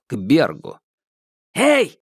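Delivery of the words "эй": high shout to stop them; calling out. Acted. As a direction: call after the fleeing guards. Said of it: "окликнул их Минхо". (оклик)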